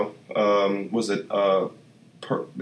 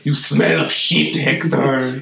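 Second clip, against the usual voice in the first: villain voice; slower than usual. Retold or imitated. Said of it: "he's like".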